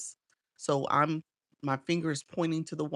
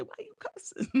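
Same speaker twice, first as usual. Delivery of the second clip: laughing; 7 dB quieter than usual. Retold or imitated. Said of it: "I was gonna say".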